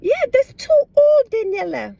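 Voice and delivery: squeaky high imitation of her friend; high mock voice of a friend. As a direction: pitch it way up like a mocking friend. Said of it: "She's like".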